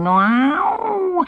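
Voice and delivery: slow drawn-out cat meow; a lightly mewed meow. Acted. Sutika imitating her own witch's-cat meow, drawn out.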